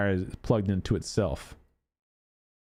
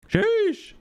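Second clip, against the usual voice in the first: high, drawn-out exclamation; long high-pitched reaction of amazement; exclaimed sheesh; 4 dB louder than usual. Real, not acted. Streamer reacting to a very pink skin, high drawn-out exclamation.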